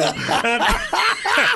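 shrieking burst of laughter; real high cackle; loud high laugh. Real, not acted. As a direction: howl with laughter. Laugh after punchline.

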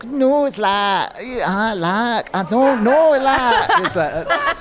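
high, drawn-out failed Scouse accent; rising, botched Scouse accent; Scouse sliding into Indian. Shown as a demonstration. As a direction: attempt Scouse and let it drift Indian. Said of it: "It will inevitably become Indian".

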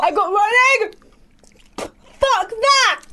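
shrieking in disgust; high-pitched yell of revulsion. Real, not acted. Tasting rotten egg bean.

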